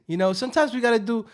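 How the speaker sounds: ordinary talk, nothing marked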